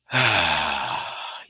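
slow, breathy exhale sighed out; audible exhale. Shown as a demonstration. Guiding listeners through a deep breath, sighs out. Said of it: "Deep breath in".

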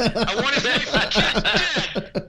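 high, laughing mobster threat; De Niro as Al Capone. Acted. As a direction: ham up a gangster boss order. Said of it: "Robert De Niro as, like, Al Capone".

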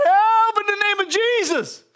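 demonstrated cry for help. Shown as a demonstration. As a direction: cry it out as an urgent prayer. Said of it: "Just cry out".

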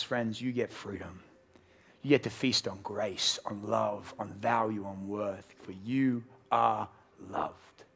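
low, slow and gentle; slow, low, reassuring close; tender assurance. Real, not acted. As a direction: ease down into comfort.

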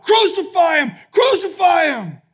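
high, loud mob cry; crowd shouting. Retold or imitated. Crowd answering Pilate about Jesus, loud shout. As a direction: bellow it like a whole mob. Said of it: "And they shout".

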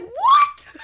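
extremely high, loud squeak of disbelief; shocked shriek. Retold or imitated. Angela hears Will has a house.

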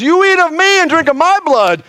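high, strained voice of Jesus; high preacher's Jesus voice; voicing Jesus. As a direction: lift the voice high, proclaiming as Jesus. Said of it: "And he goes".

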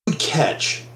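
ordinary talk

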